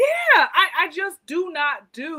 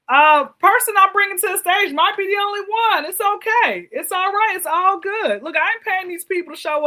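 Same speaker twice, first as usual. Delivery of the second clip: hype host voice; 6 semitones higher, 7 dB louder than usual. Real, not acted. Hyping up guest entrance.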